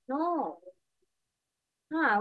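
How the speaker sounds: ordinary talk, nothing marked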